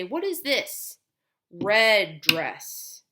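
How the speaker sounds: high, lively picture-book voice; teacher modeling. Shown as a demonstration. Therapist naming pictures with a child, lively. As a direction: coax a child to name things. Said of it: "and you would say".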